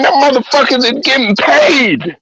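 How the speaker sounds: usual speaking voice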